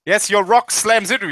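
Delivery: normal talking voice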